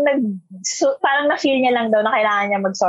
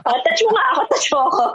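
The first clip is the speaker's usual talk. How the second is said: mock eager; 6 semitones higher, much faster than usual.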